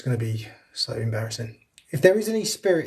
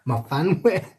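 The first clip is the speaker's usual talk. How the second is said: slow, laughing go at a Welsh accent; badly faked Welsh, amused at yourself; attempted Welsh accent. Shown as a demonstration. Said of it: "Oh, my Welsh accent".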